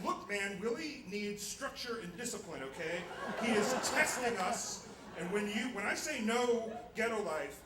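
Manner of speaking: loud, high, hammering each point; exasperated argument. Retold or imitated. Acts out argument with roommate.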